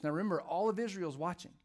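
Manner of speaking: ordinary talk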